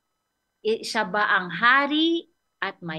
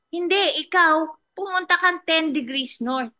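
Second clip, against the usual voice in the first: higher answering voice; 8 semitones higher, 3 dB louder than usual. Retold or imitated. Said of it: "sumagot yung boses".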